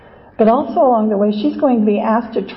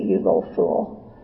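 hissed in a whisper, softer; taunting whisper; 6 dB quieter than usual. Acted. Pride taunts Much Afraid.

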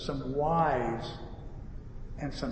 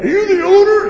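high, loud demand; irate customer; 13 dB louder than usual. Retold or imitated. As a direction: imitate a customer demanding the boss. Acting irate customer demanding owner.

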